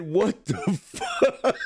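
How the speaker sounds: drawn-out mock "What the fuck?", fuck falling; slow, stretched imitation of her "What the fuck?"; imitating her. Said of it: "the way she said".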